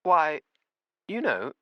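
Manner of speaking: lightly voiced old woman; lightly voiced old woman, half-surprised; old landlady. A narrator's reading. Missus Beatty starts answering.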